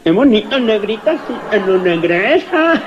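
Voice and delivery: garbled, nasalised consonants, imitated; mock muffled, mangled articulation; cleft-lip speech. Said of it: "Y el boquinche".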